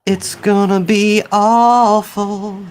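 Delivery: high, slow drawn-out groan on awful; mock dread. Cohost dreading the cartoon reboot, hammed-up despair.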